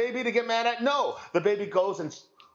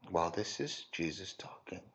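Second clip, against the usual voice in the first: much lower and softer settled answer; quiet affirmation; 13 semitones lower, 11 dB quieter than usual. Real, not acted. Preacher quietly confirms to the congregation, low and soft. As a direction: answer your own question gently and firmly.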